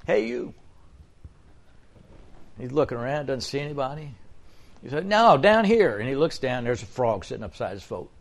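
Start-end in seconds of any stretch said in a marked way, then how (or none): 0.1-0.5 much higher, softer repeated call; frog calling. Acted. Frog calls to the fisherman. 5.1-6.0 higher, louder little frog voice; talking frog. Acted.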